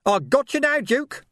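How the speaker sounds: high-pitched, triumphant cry; gleeful shout. Acted. Pursuer shouting at the fleeing Duke, gleeful. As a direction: crow it in a high voice. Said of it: "and a gleeful shout".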